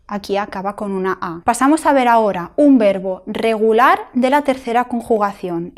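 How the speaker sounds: ordinary talk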